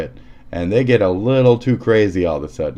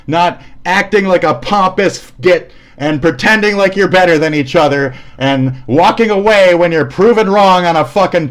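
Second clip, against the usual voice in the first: loud, worked-up lecture at the viewers; heated rant; 6 semitones higher, 5 dB louder than usual. Real, not acted. Ranting at a commenter who walked away, loud and heated. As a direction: tell the pompous git off, loud and hot.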